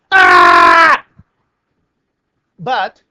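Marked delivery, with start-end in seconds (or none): 0.1-1.0 loud, much lower mock scream of horror; a strangled inner shriek. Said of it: "inside I'm going".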